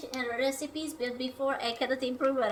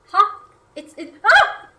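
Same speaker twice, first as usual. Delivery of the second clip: startled yelp; 8 semitones higher, 15 dB louder, slower than usual. Real, not acted. Pot foaming over.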